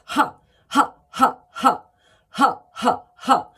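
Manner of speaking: short, loud, light ha bursts, not breathy; sharp ha. Shown as a demonstration. Coach demonstrating the ha, sharp and not heavy. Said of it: "It's sharp. It's not full of breath".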